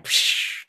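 voiceless hiss of bacon hitting the pan; sizzle sound. Shown as a demonstration. Teacher acting out bacon dropped in hot grease, sizzling hiss. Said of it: "then you hear the sizzle".

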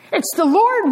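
high and bright, a sudden recognition; excited cry. Acted. Disciple in the boat to the others, an excited cry. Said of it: "one of them says".

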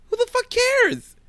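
exasperated outburst. Real, not acted. Co-host rants about Sue's contest.

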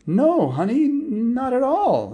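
high, slow nervous fib; a husband covering nervously, high and slow. Acted. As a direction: stammer out a jittery lie to his wife. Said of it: "the dad got kinda nervous".

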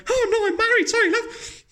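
very high, flustered squeal; mock panic. Married man turning down a woman's advance, mock panic. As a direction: squeak out a panicky, apologetic refusal. Acting his own flustered refusal.

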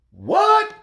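very high, squeaky What; incredulous What. Acted. Comic scolding parents over kids' devices, with a very high, squeaky What. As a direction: act floored by it. Outrage at giving kids devices.

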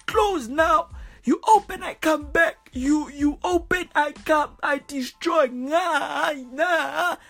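high, wailing broken-English leader; halting accented ruler, straining to forbid; dying dictator. Retold or imitated. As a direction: protest in a mock deathbed whine. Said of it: "On his fucking deathbed".

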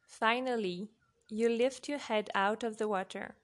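ordinary delivery, nothing marked

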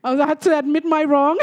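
usual speaking voice

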